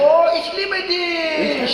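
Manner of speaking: high, loud and drawn out; hammed-up gushing German declaration; overjoyed mock reply. Imagined dad delighted by son's German, overjoyed.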